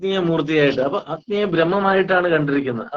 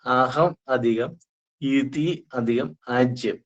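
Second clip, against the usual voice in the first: very slow അഹം, ഇതി, ആജ്യം with pauses; words split apart; much slower than usual. Shown as a demonstration. Teacher repeating the split, slow separate അഹം, ഇതി, ആജ്യം. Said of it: "എന്നോട് പറയാം".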